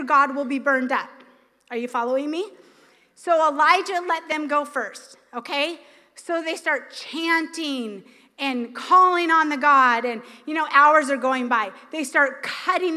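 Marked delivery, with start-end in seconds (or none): none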